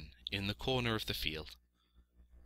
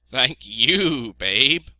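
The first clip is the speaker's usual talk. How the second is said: loud, higher bleating voice; thankful sheep; 5 semitones higher, 12 dB louder, much slower than usual. A narrator's reading.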